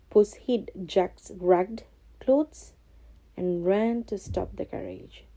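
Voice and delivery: usual speaking voice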